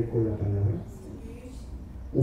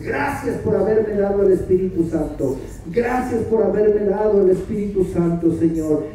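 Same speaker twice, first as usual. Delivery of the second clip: fervent prayer; 3 semitones higher, 5 dB louder than usual. Acted.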